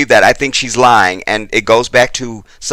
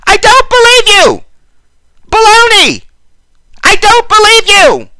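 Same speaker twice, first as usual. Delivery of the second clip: high, loud, shrill-free squawk of an outraged judge; very high, loud mock courtroom outburst; Judge Judy; 7 dB louder than usual. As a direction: blast it out as Judge Judy in disbelief. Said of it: "so Judge Judy can go".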